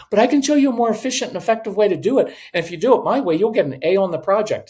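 fast, high-pitched anxious pushing. Acted. Parent pushing their way on a child's project, anxious. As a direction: rush to convince, pitch up. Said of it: "that's just our anxiety".

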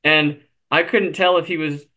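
ordinary delivery

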